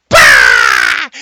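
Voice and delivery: sudden low loud bah; startled shout. Retold or imitated. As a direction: blurt it in shock.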